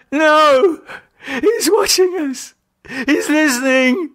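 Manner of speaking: high, crying, panicked rush; hysterical. A narrator's reading. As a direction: babble in sheer terror. David screams.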